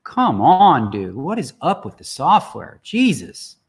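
loud, high-swooping exasperation; fed up, pitch jumping high; exasperated complaint. Real, not acted. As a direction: plead angrily with broken software. Berating frozen poker software.

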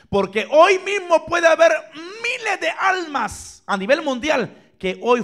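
high, loud, slow shout; preaching shout. Real, not acted. Preacher shouting a warning to the viewers, loud. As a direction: hurl the warning out.

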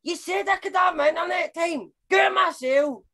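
calling back indignantly. Acted. Calling back to someone off the call.

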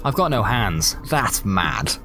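ordinary delivery

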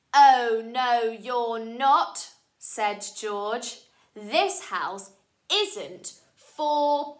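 slow, loud, bossy refusal. Acted. George barring people with glasses, slow and bossy. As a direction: drag out the rule bossily. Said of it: "He's got a very bossy voice".

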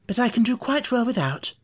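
usual speaking voice

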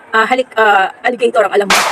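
usual speaking voice

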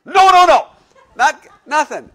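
put-on very loud, high toddler wail of refusal; cranky toddler. Toddler refusing to get dressed, loud high wail. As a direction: scream no like an overtired two-year-old. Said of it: "Okay, [name]".

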